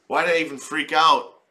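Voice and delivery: loud regret. Retold or imitated. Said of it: "She's like".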